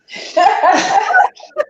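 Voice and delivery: high, teary laugh breaking out; half sob half laugh; laughing through tears. Real, not acted. Mother overcome talking about her son, teary laugh.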